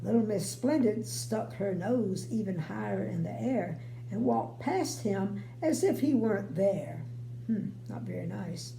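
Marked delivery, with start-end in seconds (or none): none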